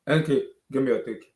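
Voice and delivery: ordinary delivery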